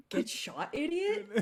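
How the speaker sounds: laughing quote. Retold or imitated. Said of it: "In the words of me".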